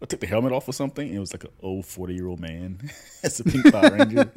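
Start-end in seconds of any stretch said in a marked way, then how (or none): 3.2-4.3 high laughing squeal. Real, not acted.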